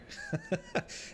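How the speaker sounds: a short high burst of laughter. Real, not acted. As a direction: laugh it off, amused. Laughs at map author's joke.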